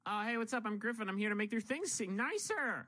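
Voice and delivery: breezy high American dude; American accent. Shown as a demonstration. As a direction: say it like a laid-back American guy. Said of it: "But say that in an American accent".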